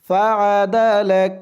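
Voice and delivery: ফাআদালাক with the lam and kaf joined as one syllable, lak; ফাআদালাক said slowly syllable by syllable, ending on a clear lak; Quran recitation demo. Shown as a demonstration. Teacher demonstrating ফাআদালাক for students, careful pronunciation. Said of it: "লাম দিয়ে কাফ ধরলে যেমন উচ্চারণ হয় তেমন উচ্চারণ হবে".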